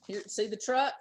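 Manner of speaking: usual speaking voice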